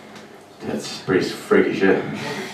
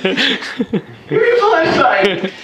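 high squeal of a please through laughter; laughing plea. Real, not acted. Begging the room for a punchline, laughing. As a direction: beg while cracking up.

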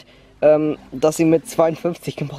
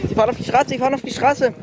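alarmed warning; 8 semitones higher than usual. Acted. Warning the rider of danger.

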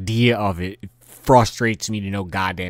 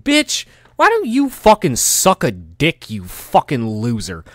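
loud, high, drawn-out tirade; angry insult; 6 semitones higher, 6 dB louder than usual. Acted. Answering someone blaming them for a mistake, angry insult. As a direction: curse them out slowly, starting high and loud. Cursing out an imagined excuse-maker.

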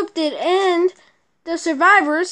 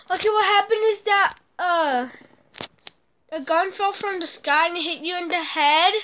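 ordinary talk, nothing marked

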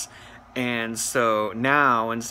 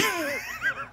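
squealing laugh; 7 dB quieter than usual. Real, not acted. High laugh at end.

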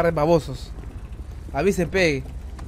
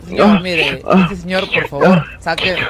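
loud pleading; 8 dB louder, slower than usual. Real, not acted. Pleading loudly with passenger.

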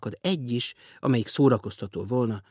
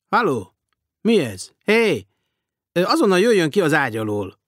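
startled shouting; 8 semitones higher, 9 dB louder than usual. A narrator's reading. Startled man finds body under bed.

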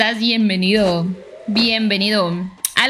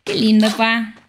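heartfelt slow gratitude to a viewer; slow warm thanks; 2 semitones lower, slower than usual. Real, not acted. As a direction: thank him slowly, from the heart. Thanking a new channel supporter.

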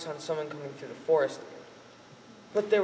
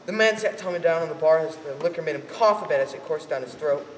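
usual speaking voice

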